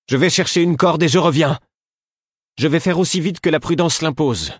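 high, loud instructions; calling out. Acted. Queen calling down to Fitz stuck on the rocks. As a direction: shout the plan down the slope. Ketriken shouts from above.

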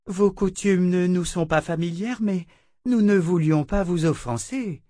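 smooth the offense over gently; sister Grace. A narrator's reading.

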